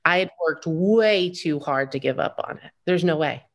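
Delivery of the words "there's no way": usual speaking voice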